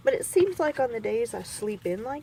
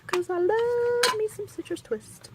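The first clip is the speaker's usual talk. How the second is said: higher, slow sing-song on Citrus Twist; sing-song gush; 13 semitones higher, 3 dB louder than usual. Real, not acted. Crafter gushing over her favorite paper line, sing-song. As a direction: savor the brand name like a treat.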